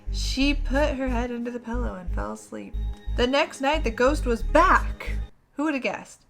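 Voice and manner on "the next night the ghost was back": usual speaking voice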